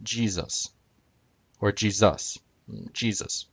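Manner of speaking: Jesus said the English way, JEE-zus; English pronunciation. Shown as a demonstration. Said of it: "When we pronounce Jesus in English".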